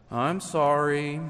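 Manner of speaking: slow, drawn-out empty apology; without feeling. Shown as a demonstration. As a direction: say sorry with no feeling in it. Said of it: "without putting a whole lot of feeling into them".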